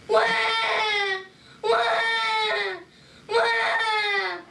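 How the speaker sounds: high mock baby cries, wah wah; loud fake infant bawling; hammed-up baby crying; baby wailing. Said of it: "it cried as loud as she could".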